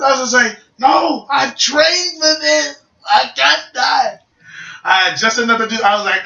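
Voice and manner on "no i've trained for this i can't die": slow, high defiant cry; hammed-up hero refusing defeat; drawn-out dramatic wail; mock desperation. Retold desperate moment in game.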